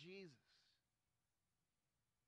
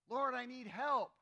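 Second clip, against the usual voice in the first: pleading call; 6 semitones higher, 10 dB louder than usual. Pretend. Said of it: "praying for help".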